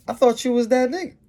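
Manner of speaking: mock him in a high jeering voice; girl turning down a bragger, high and sarcastic; sarcastic taunt. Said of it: "it's mockery and it's, uh, sarcasm".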